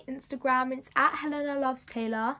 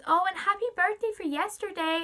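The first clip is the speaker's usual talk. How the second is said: high, lilting fake American accent; clumsy American accent in a higher voice; [name], bad American accent; 7 semitones higher than usual. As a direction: imitate an American star, pitched well up. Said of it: "I'm so bad at American accents".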